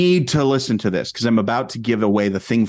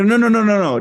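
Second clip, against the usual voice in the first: urgent insisting; 10 semitones higher, 4 dB louder, faster than usual. Retold or imitated. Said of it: "And you go".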